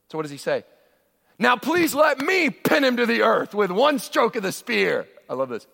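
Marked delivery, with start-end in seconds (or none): 1.4-5.1 eager soldier. Acted. Pastor voicing Abishai eager.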